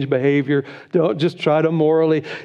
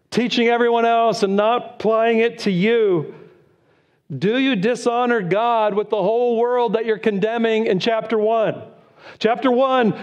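raised, ringing preacher voice; preaching at full force; 9 semitones higher than usual. Acted. Pastor preaching Romans to the church, at full force. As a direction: proclaim it from the pulpit.

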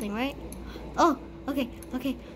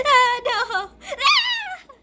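high shriek through laughter; panicked laughing scream; 10 semitones higher, 7 dB louder, much slower than usual. Real, not acted. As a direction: squeal with nervous laughter. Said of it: "I really make weird noises".

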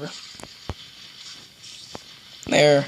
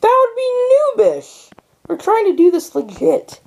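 high indignant; 14 semitones higher, 5 dB louder than usual. Real, not acted. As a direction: scoff at the idea, voice up high. Scolding friend over cheating.

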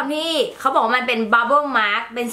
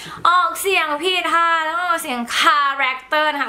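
higher, slow correcting voice; imitated commenters; 5 semitones higher, much slower than usual. Commenters correcting her pronunciation, imitated in a slow higher voice. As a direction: spell out the correction slowly, pitched up. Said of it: "มีคนคอมเมนต์มาเยอะมากนะ ว่าแบบ".

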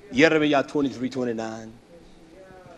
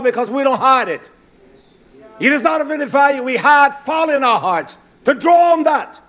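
loud, raised sermon voice, driving hard; full-force preaching; 7 semitones higher, 6 dB louder than usual. Real, not acted. Preacher rebuking congregation for not hiding the word in hearts, full-force preaching. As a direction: hammer each point home to the congregation.

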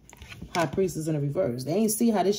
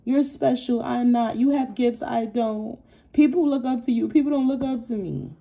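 higher, whiny mock of the envious person; mocking jealous rival; 5 semitones higher than usual. Reader mocks the jealous person's envy, in a higher whiny voice. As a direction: act out the rival's self-pity, mocking it. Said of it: "They feel like you're special".

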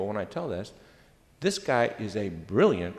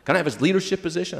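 eager colleague; 8 semitones higher, 7 dB louder, much faster than usual. Retold or imitated.

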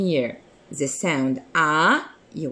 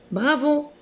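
much higher, cheerful bravo; bright praise; 10 semitones higher than usual. Real, not acted. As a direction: cheer the pupil on. Teacher praising a child. (bravo très enjoué)

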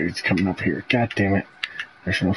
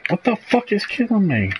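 startled outburst; 12 semitones higher than usual. Real, not acted. Dying suddenly in a shooter game.

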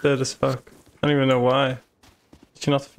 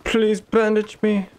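whiny pleading; 7 semitones higher than usual. Pretend. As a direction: whine it like a hurt child. Mock pleading for bandage.

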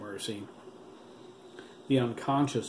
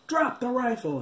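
high, loud commanding shout; order; 13 semitones higher, 5 dB louder than usual. A narrator's reading. Cop ordering the armed boy, loud commanding shout. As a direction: command it at gunpoint. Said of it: "he ordered".

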